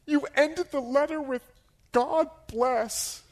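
high, mock-sobbing complaint; loud, high weepy voice; tearful accusation. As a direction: imitate her choking out the charge. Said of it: "She's crying".

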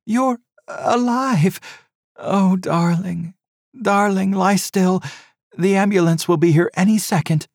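high, loud, tearful joy; overjoyed. A narrator's reading. Wife to her reviving husband, high joyful relief. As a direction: pour out relief over him.

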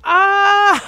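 high, loud "aha" moment; excited shout of recognition. Real, not acted. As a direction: shout it as it finally clicks. Realizes the right show name.

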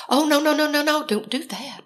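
high, hurried dispatcher protest; head off a terrible idea; alarmed objection. Retold or imitated.

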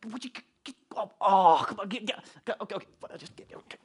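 imitating impatient muttering under the breath; exasperated sputtering. Shopper stuck behind people with a cart, muttering in mock exasperation. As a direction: sputter half-words in mock annoyance. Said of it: "I'm, like, getting mad".

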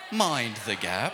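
slow, mock British Tube announcement; British accent. Shown as a demonstration. Pastor leading the crowd in a mock British accent. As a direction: say it like a London Underground voice. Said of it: "say it in a British accent".

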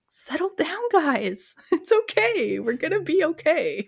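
high, soothing mock reassurance to fans; a hammed-up, high calm-down voice; calming the crowd. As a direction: pat the fans on the head, all high and gentle. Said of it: "I'm like".

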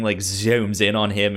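ordinary talk, nothing marked

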